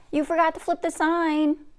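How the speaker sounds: yelling. Acted. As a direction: shout it up the driveway. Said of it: "she yells".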